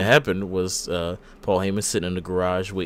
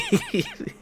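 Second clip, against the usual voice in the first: high burst of laughter; 4 dB quieter than usual. Real, not acted. Laughing at own bit.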